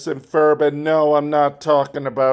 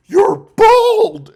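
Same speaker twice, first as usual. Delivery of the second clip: very high, loud excited SpongeBob cry; 8 dB louder than usual. Retold or imitated. Retelling the cartoon, SpongeBob shouts high and loud. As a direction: squeal it like SpongeBob. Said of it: "first SpongeBob says".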